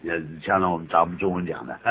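ordinary talk

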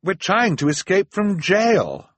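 high, loud, slow exasperated insistence. Acted. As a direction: spell it out, fed up. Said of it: "Violet cried in exasperation".

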